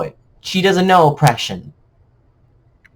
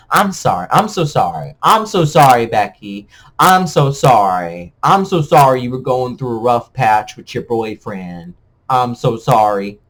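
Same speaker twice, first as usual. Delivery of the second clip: fake apology; 6 semitones lower than usual.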